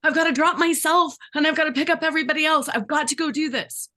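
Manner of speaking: anxious rush. Retold or imitated. Overwhelmed person feeling they must rescue everyone, anxious and rushed. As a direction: spill it out like you're overwhelmed. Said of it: "a bit of that anxiety".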